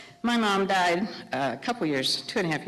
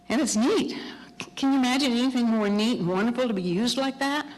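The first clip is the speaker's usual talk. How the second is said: marveling; 6 semitones higher than usual. Real, not acted. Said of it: "preacher marvels".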